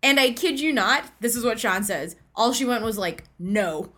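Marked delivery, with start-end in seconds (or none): 3.4-3.9 short, mildly stern retold refusal; lower-pitched, understated stern no. Retelling a woman turning down a sandwich, mildly stern. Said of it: "in a very stern way".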